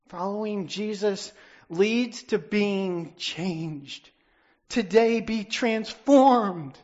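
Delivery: slow, loud and high, each word stretched; fervent preaching appeal; slow loud exhortation. Real, not acted. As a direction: call the room to change. Altar call exhortation.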